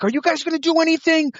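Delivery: mock panicked relative. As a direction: play the panicking relative demanding help. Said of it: "a family member freaking out".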